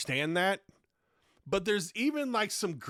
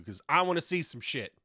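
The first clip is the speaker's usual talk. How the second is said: normal talking voice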